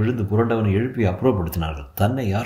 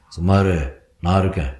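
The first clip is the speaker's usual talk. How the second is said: low, steady answering voice; low reassuring voice from within; inner voice of a god; 5 semitones lower than usual. A narrator's reading. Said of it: "உள்ளே குரல் கேட்டது".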